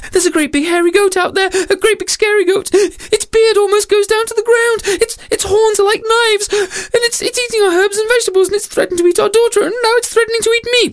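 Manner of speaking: very high, racing squeal; hysterical mother. Acted. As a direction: gabble the terrible news breathlessly. Storyteller voices panicking mother.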